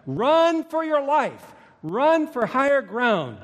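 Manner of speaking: high, drawn-out urgent shout; yelled warning. Acted. Someone on the cliff to people below the tsunami, yelled warning. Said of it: "had yelled and screamed at you".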